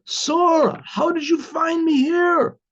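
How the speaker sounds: retold yell. Card player spots his wife in the bar, retold yell. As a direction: call out high in startled disbelief, stretching the words. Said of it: "yells out".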